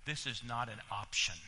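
dropped low and soft on This is not an option; low quiet warning. Acted. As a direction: say it under your breath, heavy and grave. Repeated warning to congregation.

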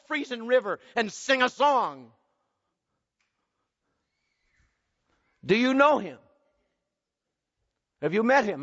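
rising sermon appeal; preaching appeal. Acted. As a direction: drive the question home to the congregation.

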